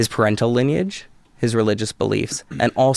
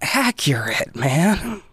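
stunned whisper; 5 semitones higher, much slower than usual. Retold or imitated. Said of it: "I was like".

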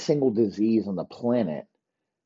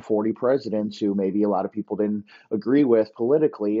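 usual speaking voice

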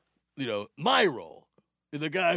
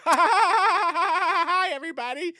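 loud, high forced laugh into a hello; hammed-up frantic, over-eager entrance; panicked nervous greeting; 14 semitones higher, 10 dB louder than usual. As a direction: cackle nervously, then greet the room. Nervous entrance, sweating, greets room.

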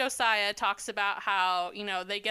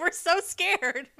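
higher, slow, drawn-out fake fear; mock scared; 7 semitones higher, 4 dB louder, much slower than usual. Host mimics how others would feel, hammed-up mock fear. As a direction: stretch out a hammed-up fright. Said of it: "They're like".

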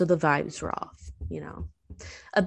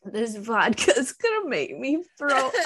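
laughing; 7 semitones higher than usual. Real, not acted. Gagging on vodka drink.

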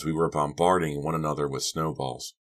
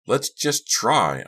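higher, louder and drawn out; eager, raised-voice urging; urgent plea; 8 semitones higher, 6 dB louder, much slower than usual. A narrator's reading. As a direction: push them to try, stretching it out. Urging the others to act.

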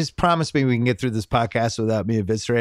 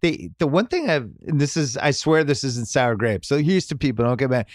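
normal talking voice

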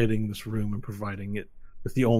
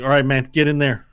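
loud, raised-pitch order; urging street-guy guide; 5 semitones higher, 11 dB louder than usual. Acted. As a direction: urge them in, loud and higher. Guide orders players into crack.

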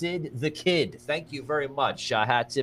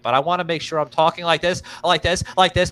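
talking fast; 6 semitones higher, 7 dB louder than usual. Real, not acted. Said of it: "talking a mile a minute".

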